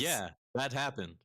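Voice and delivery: a try at a robot voice. Shown as a demonstration. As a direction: stiff mock robot voice. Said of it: "I'm trying to do the, the robot voice for you".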